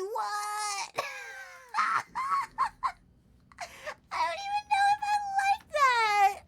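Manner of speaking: high, laughing bewilderment; laughing disbelief. Real, not acted. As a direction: laugh it out, unsure you like it. Laughing shock at the shiny.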